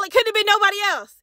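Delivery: fast, high, worked-up insistence; excited. Real, not acted. Insists she was the obvious culprit.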